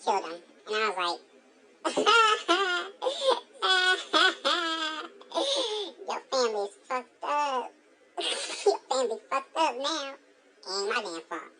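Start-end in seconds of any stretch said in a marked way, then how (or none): 1.8-10.1 high, gleeful cackling taunt; cackle, then taunt through leftover laughter; mocking laughter. Taunting Jody after she hit her father, mocking laughter.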